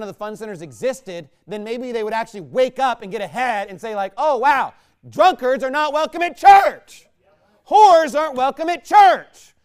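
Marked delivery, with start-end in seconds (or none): 4.2-9.5 high, loud proclamation; preaching shout. Acted. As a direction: thunder it out to the congregation. Imagined realization, preached at full force.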